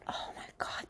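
hushed whisper about donuts. Real, not acted. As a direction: whisper it like a secret.

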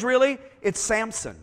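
ordinary talk

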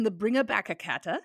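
imitating a child's bad Italian accent; mock Italian, vowels tacked onto the words; lightly hammed-up Italian accent. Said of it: "doing a bad Italian accent".

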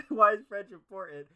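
amused reading while laughing. Real, not acted. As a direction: read it cracking up.

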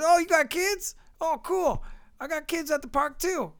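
high-pitched, delighted small talk; eager chatty stranger. Retold or imitated. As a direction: imitate an over-friendly stranger, pitched high.

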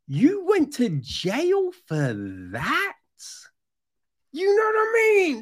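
exasperated high shout; incredulous shout. Real, not acted. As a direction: throw it out in disbelief. Incredulous rant to listener.

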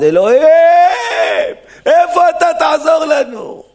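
high, loud pleading shout to God; desperate cry. Retold or imitated. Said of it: "הם היו צועקים".

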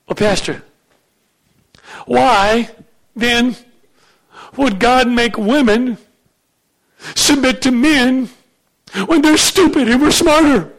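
high-pitched, put-on questioning voice; woman challenging the pastor. Woman asks pastor why submit to stupid men, mock indignant.

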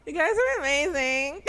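high-pitched fangirl awe; gush, almost lost for words; starstruck gushing. Retold or imitated. Fan tells the wrestling couple, high starstruck gush. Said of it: "get any words out".

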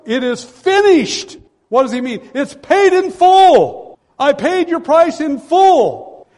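loud, high, slow ringing proclamation; preacher voicing Christ's victory cry; triumphant cry. Jesus' cry from cross.

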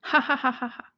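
eerie faint ha ha ha laugh; hollow giggle from somewhere in the house; creepy child laugh. Acted. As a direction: laugh like a ghost child hiding. Unseen children laugh eerily.

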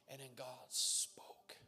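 slow whisper on God speaking; hushed, reverent whisper for dramatic weight; hushed awe. Real, not acted. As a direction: drop to a soft, drawn-out whisper.

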